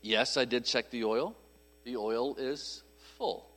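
low, soft, held-back answer; restrained, not sarcastic. Acted. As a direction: rein it in, slowing and softening to the end. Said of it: "but I was not".

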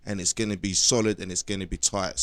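ordinary talk

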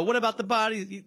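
high, mock reporter question. Reporter grills a neighbor, lightly mock high voice. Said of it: "they go".

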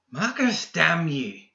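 high, strained angry cry; angry outburst. A narrator's reading. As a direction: spit the name out in anger. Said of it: "McMorning growled".

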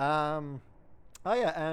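ordinary talk, nothing marked

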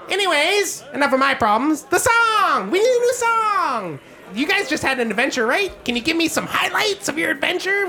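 high, chirpy innkeeper gnome; Gilly the gnome. Acted. Gnome innkeeper offering ales, high and chirpy.

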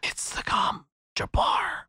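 slow, portentous stage whisper; mock sci-fi whispered voiceover; whispering to themselves. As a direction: whisper it like a cryptic inner thought. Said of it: "everybody's whispering to themselves".

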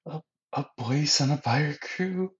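high, stammering, put-on amazement; awestruck disbelief. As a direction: stammer the offer back in a high voice. Gaimon astonished by the offer.

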